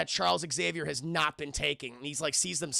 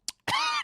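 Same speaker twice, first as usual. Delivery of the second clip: hacking mock choke; imitated choking cough; 3 dB louder than usual. Logan pounding whiskey, imitated choking cough. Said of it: "Just choking to death".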